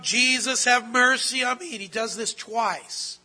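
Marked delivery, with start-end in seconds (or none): 0.0-1.7 louder, pleading cry. Retold or imitated. Preacher voicing the blind beggar calling to Jesus, loud and pleading. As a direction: call out for mercy like the blind beggar. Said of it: "And he's crying out".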